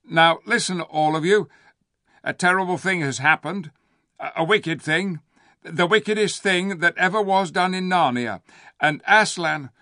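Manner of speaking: high, loud ape proclamation. A narrator's reading. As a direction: announce it loudly to the crowd. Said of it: "began in a louder voice".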